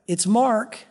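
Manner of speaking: usual speaking voice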